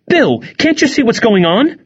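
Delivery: high, urgent plea; pleading. A narrator's reading. Mom begging dad to see what's happening, pleading. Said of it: "Mom pleaded".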